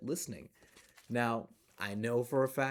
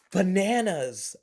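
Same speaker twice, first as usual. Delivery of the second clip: loud, slow bananas; excited; 6 semitones higher, 10 dB louder, slower than usual. Acted. Storyteller surprises kids with the answer, excited. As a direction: burst out with it.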